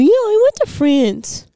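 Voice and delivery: put-on high, slow, innocent kid voice; a high childish voice, drawn out; mimicking a five-year-old. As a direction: play the little kid insisting.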